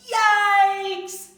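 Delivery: high, loud startled yelp of a shocked dad. Acted. Father seeing the ice cream cart, loud startled yelp. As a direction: jump out of your skin.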